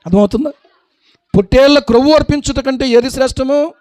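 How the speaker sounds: ordinary delivery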